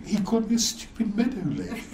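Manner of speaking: laughing through the quote; can't stop chuckling while quoting; giggling retelling. Real, not acted.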